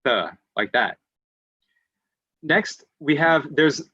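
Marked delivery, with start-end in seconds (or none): 0.0-0.3 "th" with tongue poking between the teeth; demonstrated TH sound. Shown as a demonstration. Teacher showing learners the sound, deliberate TH. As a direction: stick the tongue out for "th". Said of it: "kinda like you're sticking your tongue out".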